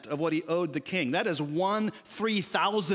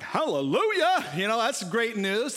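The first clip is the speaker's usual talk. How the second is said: mock joyful hallelujah; 6 semitones higher, 5 dB louder than usual. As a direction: cheer like your mortgage just vanished. Debtor hears debt written off.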